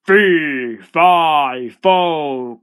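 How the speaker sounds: very loud, booming giant chant; thundering storybook giant; falling giant's roar. A narrator's reading. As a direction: roar each word while tumbling. Giant roars while tumbling down.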